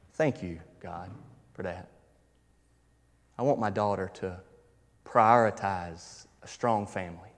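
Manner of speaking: quiet prayer. Retold or imitated.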